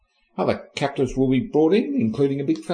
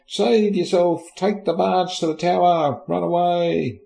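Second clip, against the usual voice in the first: higher-pitched urgent plea, lightly hammed; mock alarm; 7 semitones higher than usual. Council begging Mary to flee London, mock alarm. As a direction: urge escape in a raised mock-worried voice.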